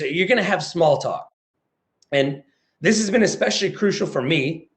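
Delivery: usual speaking voice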